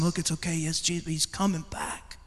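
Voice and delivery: put-on mother quietly comforting her boy; imitated calming mother. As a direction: gently soothe an upset child. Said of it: "she calmed him down".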